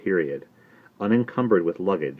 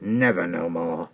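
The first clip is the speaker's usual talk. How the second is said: solemn vow. Acted. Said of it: "an ashy and solemn visage".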